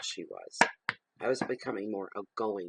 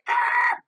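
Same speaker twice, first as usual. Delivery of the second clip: very high, loud "Ah" shriek; short piercing shriek of mock alarm; mock scream; 11 dB louder than usual. Acts the crowd's alarmed reaction.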